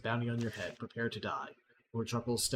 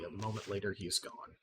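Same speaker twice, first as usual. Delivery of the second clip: ordinary talk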